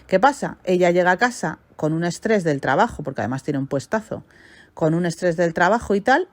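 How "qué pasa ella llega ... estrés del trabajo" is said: ordinary talk, nothing marked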